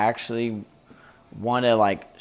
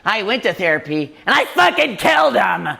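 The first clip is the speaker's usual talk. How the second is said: mock psycho; 7 semitones higher, 5 dB louder than usual. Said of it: "quoting the unhinged stranger".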